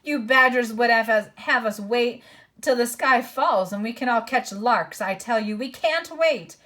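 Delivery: loud, bitter dwarf; gruff-tempered dwarf snapping; angry dwarf voice. A narrator's reading. Voicing Nikabrik the dwarf.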